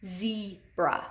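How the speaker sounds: "Zebra" slowly split into ze, then bra; slow teacher demo of "Zebra" in two beats; syllable by syllable. Shown as a demonstration. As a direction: hold the long E of "Zebra", pause, then bra. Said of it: "Where do we hear that long E?".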